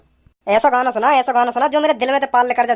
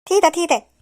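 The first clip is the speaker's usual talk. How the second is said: put-on high childlike lisp, S and CH turned to T; high-pitched tongue-tied lisper; lisping character; 5 semitones higher than usual. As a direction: pipe up in a lisped voice. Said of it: "तोतली जैसा कोई ना".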